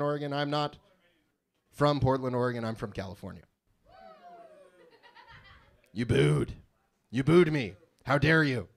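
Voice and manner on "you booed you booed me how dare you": mock indignant. Crowd boos him.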